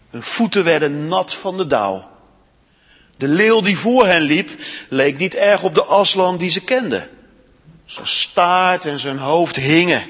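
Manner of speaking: expressive read-aloud storytelling; story reading. A narrator's reading. Storyteller reading Narnia to children in church, expressive.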